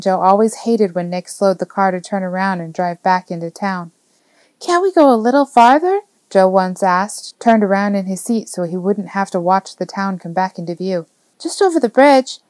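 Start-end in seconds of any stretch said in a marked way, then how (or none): 4.6-6.0 put-on high, wheedling child's voice; pleading little boy. A narrator's reading. Boy begs his driver to keep going, pleading. 11.4-12.4 put-on high child's coaxing; pleading little boy. A narrator's reading. Boy begs to cross the river, pleading.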